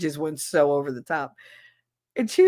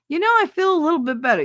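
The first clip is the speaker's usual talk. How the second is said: put-on higher and fast, a kid backpedaling; sheepish kid; 10 semitones higher, 5 dB louder, much faster than usual. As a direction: rush it out like a kid caught faking. Sick kid suddenly recovering.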